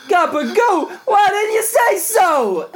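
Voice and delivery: very high, loud, drawn-out gangster yell; Sopranos mobster accent. Retold or imitated. Cupid won over by gabagool, hammed-up mobster accent. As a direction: crow it like a thrilled cartoon mobster. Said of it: "one of the actors from The Sopranos".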